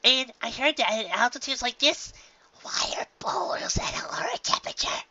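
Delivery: high breathy whisper; spooky secret whisper. Acted. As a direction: tell a scary secret to friends. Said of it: "divulge a terrifying secret".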